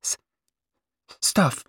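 stammered one-word dismissal; slight stammer. A narrator's reading. Youngest sister delivers one shattering word, stammering. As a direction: catch on the first sound, then land it. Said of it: "deep little voice that just quivered on a stammer".

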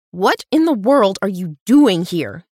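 loud, slow, shocked demand; startled cackling outburst. A narrator's reading. Woman finds a stranger on her porch, loud shocked demand. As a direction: Bella blurts it out loud and slow, caught off guard. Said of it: "Bella cackled".

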